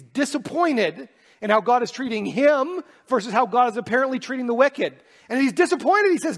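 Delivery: loud, high, building preacher voice; rising preaching, climbing in pitch and volume; impassioned sermon climax; preaching at full force. Acted.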